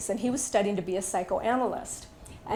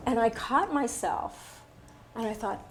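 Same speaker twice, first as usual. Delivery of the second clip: ordinary talk, nothing marked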